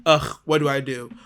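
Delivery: ordinary talk, nothing marked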